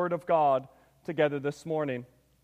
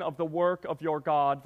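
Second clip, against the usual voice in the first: normal talking voice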